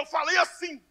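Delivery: ordinary talk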